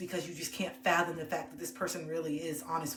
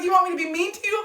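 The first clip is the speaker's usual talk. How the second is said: baffled friend; 12 semitones higher, 10 dB louder, faster than usual. Retold or imitated. Friend's reply in retold scene.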